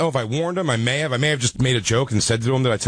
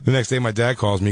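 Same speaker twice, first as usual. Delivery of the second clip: ordinary talk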